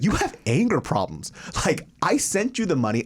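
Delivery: retelling your own heated comeback; retold indignant scolding. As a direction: argue your case, exasperated. Retold argument with angry caller.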